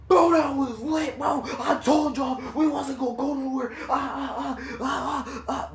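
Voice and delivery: hammed-up cousin bragging he was right; annoying cousin. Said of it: "bro, he's annoying".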